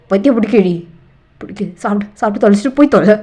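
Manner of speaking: loud, exasperated outburst; angry outburst. Acted. Man driven mad orders partner to eat and leave, angry outburst. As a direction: lash out in frustration at full volume.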